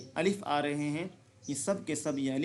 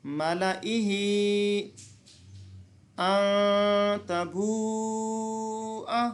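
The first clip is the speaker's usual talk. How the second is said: very slow, higher, long held chant; Quranic recitation; 4 semitones higher, much slower than usual. Shown as a demonstration. Teacher reciting the verse for students. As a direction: hold each vowel long in recitation. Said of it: "तो पढ़ेंगे".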